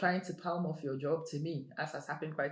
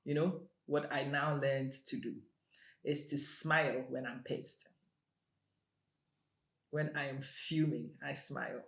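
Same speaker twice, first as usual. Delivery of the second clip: normal talking voice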